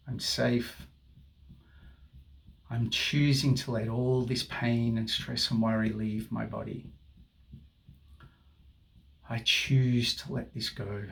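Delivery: slow, steady affirmation voice; soothing tapping guide; guided affirmation. Acted. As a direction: speak each phrase as a calming mantra.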